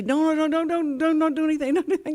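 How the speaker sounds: high, rushed mild pleading; pleading wife. Retold or imitated. Wife begging the stranger not to interfere, high and hurried. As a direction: beg her not to interfere, quickly. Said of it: "she'd say".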